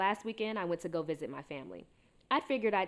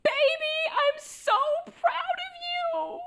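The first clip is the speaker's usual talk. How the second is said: very high, loud tearful squeal; happy crying; 8 dB louder, much slower than usual. Acted. Mother proud of daughter in therapy, happy hammed-up crying. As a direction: wail it proudly through a big smile, drawn out. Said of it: "she starts crying and looks at me with this big smile".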